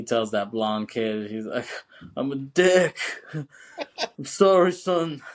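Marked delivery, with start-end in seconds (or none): 2.2-5.2 loud, higher, slow drawn-out sorry; hammed-up apology breaking into laughter; comic British apology. John apologizing to the blonde kid, comic exaggerated apology. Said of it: "it's funny how he says that".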